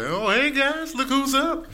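high, bright teasing call; cheery mock greeting. Greeting the lost son across the street, high cheery. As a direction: wave him over sweetly.

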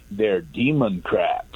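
"demon crats" drawn out slowly in two parts; deliberate wordplay. Retold or imitated. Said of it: "that calls them demon crats".

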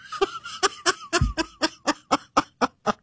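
high, breathless run of laughter; real laughing fit. Real, not acted. Host cracking up at his assistant's joke, real laughing fit.

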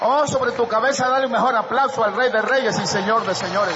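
high, loud call for applause; rousing preacher shout. Real, not acted. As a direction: shout it out over a cheering congregation. (arenga a gritos del predicador)

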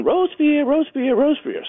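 very high sing-song calling out; a mother duck calling her lost duckling; high anxious calling, name repeated. Retold or imitated.

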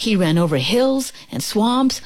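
plain reading voice

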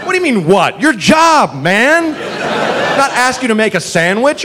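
exasperated complaint with high swoops; indignant retort. Acted. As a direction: argue back in mock disbelief, pitch swooping high. Indignant reply to the cop.

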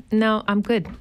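ordinary delivery

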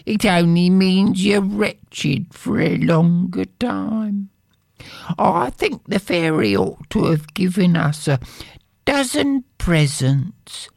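high, complaining poor wife; wife's voice. Acted. Wife grumbling to her husband about poverty, high voice. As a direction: sigh over being poor.

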